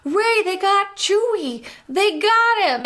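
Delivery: high, slow mock alarm; hammed-up panic. Finn warns Rey about Chewie in high mock panic. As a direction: play Finn's panic big and silly. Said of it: "he's like".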